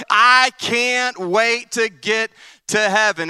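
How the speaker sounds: slow, high stretched eagerness; drawn-out excited preacher; drawn-out eager. Real, not acted. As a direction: stretch it out with longing.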